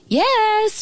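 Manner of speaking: high, long "yes"; girl calling from a distance; shouting. A narrator's reading. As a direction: holler it high and drawn out. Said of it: "shouted the girl".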